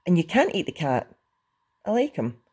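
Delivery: losing calm. A narrator's reading. Hiccup defends the cat from Toothless, losing calm. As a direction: object as patience runs out. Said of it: "feeling all his calmness deserting him".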